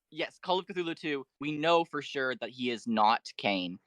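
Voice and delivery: usual speaking voice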